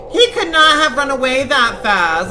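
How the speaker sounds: loud, high burst of disbelief; frustrated shout. Real, not acted. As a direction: yell it at the screen in disbelief. Shouting at the fleeing clown.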